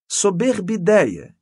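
a lightly voiced toady, drawn out; servile. A narrator's reading. Coadjutor approving the canon's idea, servile. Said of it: "com sua voz servil".

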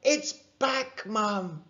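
excited cry. A narrator's reading. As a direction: burst out with relief and joy. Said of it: "cried Rory".

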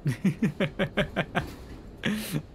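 real high-pitched laugh. Real, not acted. Laughing at the boss reveal.

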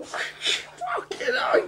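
laughing cry. Real, not acted. Overcome while hugging dog.

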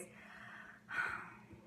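slow breathy exhale; soft, drawn-out sigh; long sigh. Real, not acted. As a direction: breathe out to regain composure. Regaining composure before going on.

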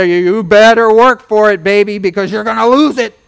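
mock a fear-mongering preacher; threatening preacher mock. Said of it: "People have used this one to put fear and trembling in people".

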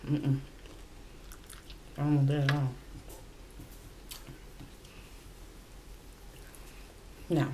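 eating between words; talking while chewing. Real, not acted. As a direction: mouth full of chicken. Seen 3: Chewing chicken wings, food at mouth throughout the labelled speech.

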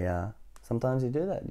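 plain reading voice

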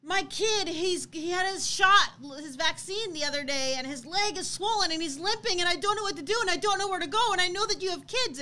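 high-pitched frantic retelling of a panicked mother; imitated hysteria. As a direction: mock-desperate plea, spilling out worries.

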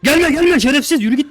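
very high, loud yelling; panicked yell. Real, not acted. Player cursing an approaching monster, panicked yell. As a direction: scream abuse to drive it off.

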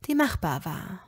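ordinary delivery

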